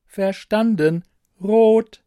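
a helpful machine, evenly spoken; computer voice. Acted. Computer misunderstands.

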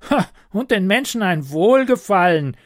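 high, loud sneering goblin; mocking goblin. A narrator's reading. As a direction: scoff at her wish, goblin. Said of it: "höhnte der Kobold".

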